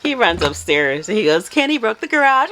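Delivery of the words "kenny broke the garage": high-pitched mock tattletale; teasing sing-out to report a culprit; petty tattling. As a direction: imitate someone gleefully telling on you. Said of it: "he's so petty".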